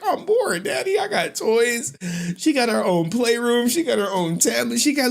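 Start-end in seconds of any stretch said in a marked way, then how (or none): 0.0-2.0 high whiny kid imitation; daughter's voice. Daughter complaining to Dad of boredom, high mimicked whine. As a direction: whine like a little girl, pitched up. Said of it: "She like".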